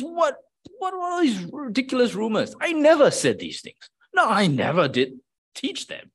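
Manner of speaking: high, incredulous protest against the rumours; an offended man protesting he never said it, pitched high; indignant. Pretend. A pretend proud Paul protesting the rumours, indignant and high. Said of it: "with indignation".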